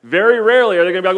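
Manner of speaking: usual speaking voice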